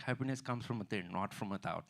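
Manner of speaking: put-on Indian-accented guru; sage-like Indian accent. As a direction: deliver a platitude as profound in an Indian accent. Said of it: "say basic shit in Indian accent".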